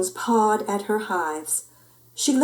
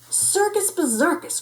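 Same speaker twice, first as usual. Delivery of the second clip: high, exasperated mother's groan; groaning mama; 10 semitones higher than usual. A narrator's reading. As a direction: groan it in disbelief. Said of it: "groaned Mama".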